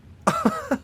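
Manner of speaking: sudden high-pitched burst of laughter; high villainous laugh. Acted. Villain amused at forgetting the introduction, high laugh.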